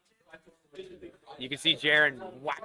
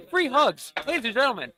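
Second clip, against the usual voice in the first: excited; 10 semitones higher, 7 dB louder than usual. Real, not acted. Announcing to the crowd.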